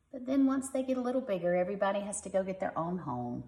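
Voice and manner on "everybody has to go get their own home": ordinary delivery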